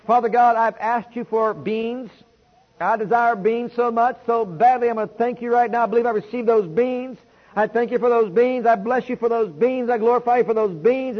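loud, pleading prayer to God; fervent prayer. Pretend. Preacher acting out a loud prayer to God. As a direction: cry out the thanks with full force. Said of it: "get on my face every day and say".

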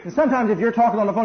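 usual speaking voice

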